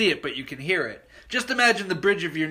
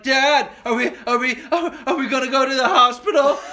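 put-on higher, louder stammering worried kid; scared stammer; 7 semitones higher, 6 dB louder than usual. Boy to his dad in the car, scared stammer. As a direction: ask it like a frightened boy. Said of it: "I'm like".

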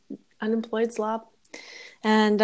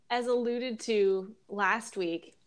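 ordinary delivery

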